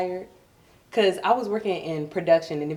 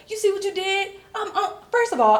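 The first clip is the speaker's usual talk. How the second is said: exasperated to cousin; 13 semitones higher than usual. Retold or imitated. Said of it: "I was like".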